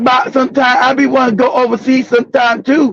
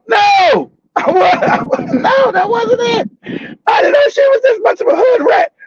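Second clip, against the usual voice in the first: high shouted protest; frantic denial; 9 semitones higher than usual. Real, not acted. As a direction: deny it in a panic. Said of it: "protesting his innocence".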